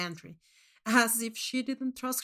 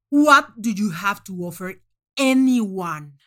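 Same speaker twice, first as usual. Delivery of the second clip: roaring contempt; 11 dB louder than usual. Acted. Manx raging at contestant.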